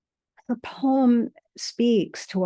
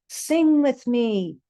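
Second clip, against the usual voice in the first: higher, louder beckoning call; 6 semitones higher, 5 dB louder than usual. Acted. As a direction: call out to invite. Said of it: "he calls".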